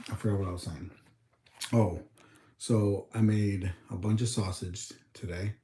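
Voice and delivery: ordinary talk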